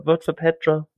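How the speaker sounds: high mock Mexican accent; brief mock Mexican accent. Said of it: "that's a bit more Mexican".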